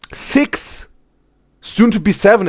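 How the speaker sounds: ordinary delivery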